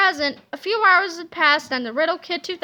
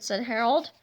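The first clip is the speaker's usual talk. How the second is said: ordinary delivery, nothing marked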